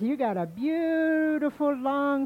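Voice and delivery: high-pitched gushing admiration; flattering. Acted. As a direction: gush over it, pitch lifted. Rabbit flatters squirrel's tail.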